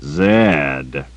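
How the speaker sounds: a drawn-out, demanding Zed; demanding drawl. A narrator's reading. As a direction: stretch the name, not buying it. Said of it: "in a demanding drawl".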